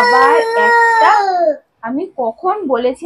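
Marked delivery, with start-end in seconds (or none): 0.0-1.1 high, loud and slow cry of dismay; exasperated cry. Real, not acted. Mother crying out as daughter adds another stroke, loud and high. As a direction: cry out at the mistake.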